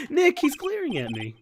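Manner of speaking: high-pitched sidekick voice; uneasy sidekick. Acted. Sidekick tells Nick about glaring, high voice. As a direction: pitch it way up, complaining to a friend.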